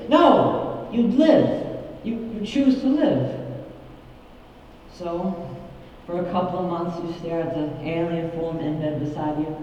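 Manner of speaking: ordinary talk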